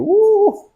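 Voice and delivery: high, drawn-out "uuh"; taken-aback "ooh". Retold or imitated. Preacher recalling his reaction to her shocking answer, high "uuh". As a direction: let out a high startled hoot.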